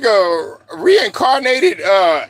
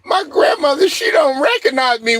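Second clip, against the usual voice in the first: higher-pitched whiny imitation; mocking, raised-pitch lament; mock complaint; 6 semitones higher than usual. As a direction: mimic someone bemoaning grandma. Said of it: "and then she'll be like".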